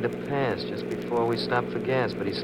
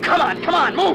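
higher, tense, building to a shout; will the old car on, getting louder; urgent urging; 7 dB louder than usual. Acted. Driver urges car on.

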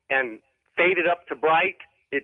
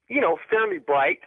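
normal talking voice

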